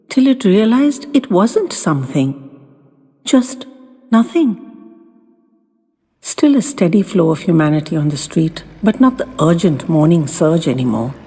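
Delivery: ordinary delivery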